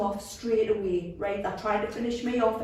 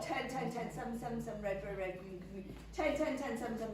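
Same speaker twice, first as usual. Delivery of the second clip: soft, fading mumble of numbers; muttering; 2 semitones lower, 16 dB quieter, faster than usual. Acted. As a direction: drift off muttering to herself. Patient muttering numbers, exhausted.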